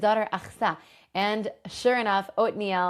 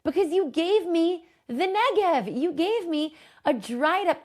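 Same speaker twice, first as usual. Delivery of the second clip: higher, slow, pleading daughter's voice; pleading Achsa; 9 semitones higher, 3 dB louder, slower than usual. Retold or imitated. Achsa begs her father Kalev for a blessing, high slow plea. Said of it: "she says to him".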